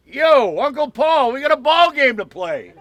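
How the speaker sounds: loud, high call across a ballpark; imitated holler. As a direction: bellow it to your uncle, pitched high. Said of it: "Just like that".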